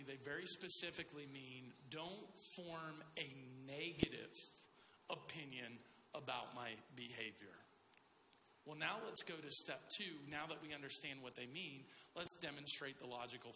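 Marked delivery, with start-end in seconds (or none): 1.9-7.6 low, soft, slow "negative" set apart by pauses; measured, lowered delivery isolating "negative"; deliberate emphasis. Shown as a demonstration. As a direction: spell it out slowly, pausing before and after "negative". Spelling out the real meaning.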